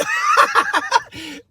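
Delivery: sudden high-pitched real laugh; burst of laughter. Real, not acted. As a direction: crack up at your own joke. Cracks up at Ben Franklin joke.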